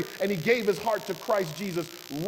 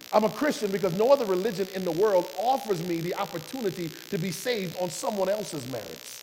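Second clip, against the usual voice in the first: ordinary delivery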